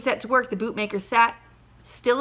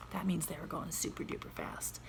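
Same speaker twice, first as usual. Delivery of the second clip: much softer, unvoiced whisper; whispered aside; 5 semitones lower, 16 dB quieter than usual. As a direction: explain a secret to the class. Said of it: "teacher aside whisper".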